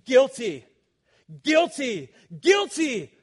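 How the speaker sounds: loud, high, ringing declaration of guilty; stern judge of the universe pronouncing sentence; judge's verdict. Acted. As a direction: boom each guilty like a gavel falling. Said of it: "he will declare".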